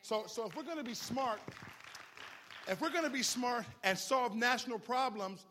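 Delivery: loud, high, rising sermon climax; fiery pastor driving the sermon home; full-force preaching. Acted. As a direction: preach it hard to the congregation.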